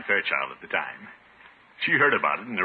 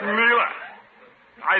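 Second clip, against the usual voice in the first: surprised; 12 semitones higher, 4 dB louder than usual. Acted. Startled greeting.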